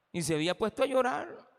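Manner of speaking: usual speaking voice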